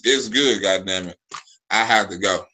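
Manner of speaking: normal talking voice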